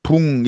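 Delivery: "붕" with final ng for book; Korean mispronunciation. Shown as a demonstration. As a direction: book turned into "붕". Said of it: "이렇게 발음하고 있다".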